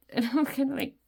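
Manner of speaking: giggling through the words; words through a real giggle. Real, not acted. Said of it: "I'm giggling".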